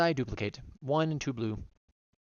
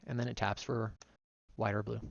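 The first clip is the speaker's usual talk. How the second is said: ordinary talk, nothing marked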